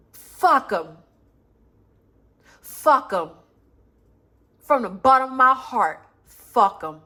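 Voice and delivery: emphatic dismissal. Real, not acted. Dismissing hurtful lovers emphatically.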